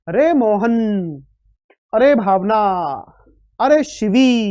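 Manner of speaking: long drawn-out call, vowels held; calling out names. Shown as a demonstration. Teacher shows pupils how names are called with prolonged vowels. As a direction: call each name across a distance, stretching the vowel. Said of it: "जैसे किसी का नाम पुकारते हैं".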